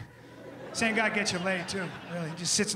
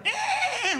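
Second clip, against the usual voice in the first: high laughing yelp; mock highland whoop; 4 dB louder than usual. A Scottish noble whooping off his steed. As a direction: whoop it up like a wild rider. Angus's horse-stunt yell.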